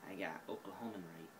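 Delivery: whispered. Acted. Proud whisper to camera after nailing the Oklahoman accent. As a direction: whisper it to yourself like a secret. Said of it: "that sounded a lot more like Oklahoman".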